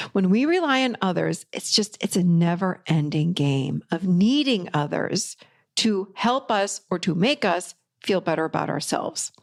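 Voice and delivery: normal talking voice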